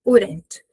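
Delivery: Wouldn't with the D as a quick tapped R, wou-rent; flapped D. Shown as a demonstration. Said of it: "esse D ganha o som do R e fica rent".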